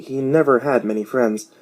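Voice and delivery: plain reading voice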